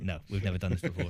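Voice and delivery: mock-guilty admission; sheepish porter. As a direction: porter caught out by a hotel guest. Porter admits inexperience, laughing.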